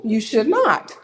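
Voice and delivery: NOT hit loud and hard; firm no. Real, not acted. Speaker rejecting the friend's advice, firm and loud. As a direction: shut the idea down on not.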